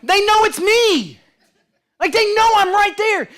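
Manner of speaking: high, loud incredulous exclamation; loud, high-pitched bafflement; amused disbelief. Real, not acted. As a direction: exclaim it in comic exasperation. Incredulous at kids' fear, telling story.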